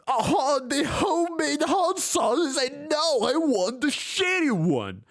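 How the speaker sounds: high, drawn-out mock complaint; mocking mimicry. Mocking the diner who rejects homemade hot sauce, high mocking whine.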